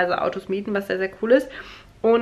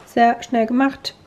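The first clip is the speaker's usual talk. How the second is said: ordinary talk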